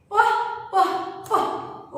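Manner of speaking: प as a voiceless puff of air; puffed p sound. Shown as a demonstration. Teacher showing kids the breathy p puff. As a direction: pop प with just breath, no voice. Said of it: "okay".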